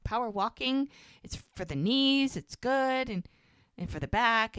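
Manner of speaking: lightly acted, singsong justifying; backpedalling. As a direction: imitate a partner talking his way out of it. Retold partner backpedalling after objection.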